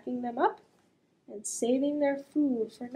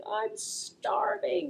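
hungry dog; 6 semitones higher, much slower than usual. Acted. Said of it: "the dog is thinking".

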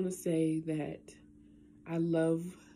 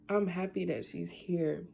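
ordinary delivery

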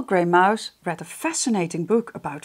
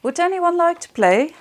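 much higher small squeaky voice; little mouse voice; 10 semitones higher, 3 dB louder than usual. Acted. Mouse asks friends to play, high little voice. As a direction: ask hopefully in a tiny voice. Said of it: "with his Little Grey Mouse voice".

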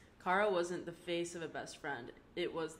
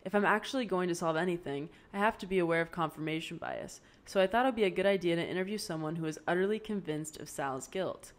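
louder, steady recorded log voice; spoken log entry, firm and a bit louder; project log entry; 6 dB louder than usual. Acted. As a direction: dictate it into the recorder like a case file. Recording her capstone project log.

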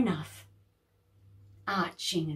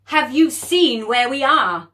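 loud, angry father bellowing up the stairs; cross dad; 5 semitones higher, 12 dB louder than usual. Acted. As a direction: tell the girls off loudly. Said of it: "now Dad was cross".

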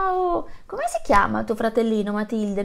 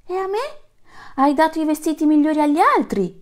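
much higher, louder indignant cry; shouting giraffe; 8 semitones higher, 5 dB louder, faster than usual. Acted. Giraffe protests to the lion king, high and loud. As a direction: complain like a slighted giraffe. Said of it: "gridò una giraffa".

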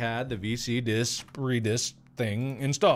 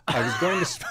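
laughing; 8 semitones higher, 5 dB louder, faster than usual. Real, not acted.

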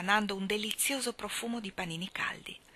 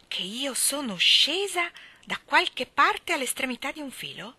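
terrible voice; 7 semitones higher, 6 dB louder than usual. A narrator's reading. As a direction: let the outrage build. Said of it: "era più terribile del suo sguardo".

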